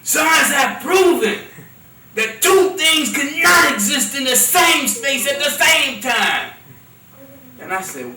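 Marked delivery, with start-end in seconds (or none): 0.0-6.5 loud, slow and higher, pressing on proven; mock preachy shout. Repeating the brother's claim, loud slow shout. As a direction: shout it like a street debater. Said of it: "And the brother said".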